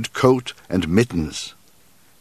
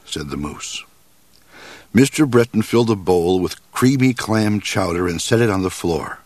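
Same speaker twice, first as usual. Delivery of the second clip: ordinary delivery, nothing marked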